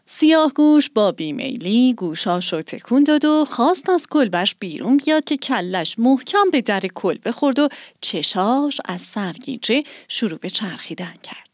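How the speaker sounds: ordinary delivery, nothing marked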